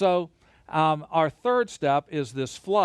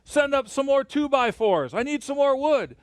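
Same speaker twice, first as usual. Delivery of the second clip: higher and louder, calling up to Joe; foreman calling down; 9 semitones higher, 3 dB louder than usual. Retold or imitated. Foreman on the tower yells to Joe for wood, calling out. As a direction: shout down from the top of the tower. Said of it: "he goes".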